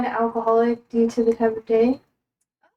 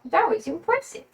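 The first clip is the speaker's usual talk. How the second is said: higher-pitched doting pet talk; talking to a dog; 5 semitones higher than usual. Real, not acted. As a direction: coo praise at your dog. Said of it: "I'm talking to my dog".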